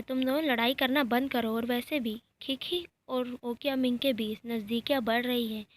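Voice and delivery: usual speaking voice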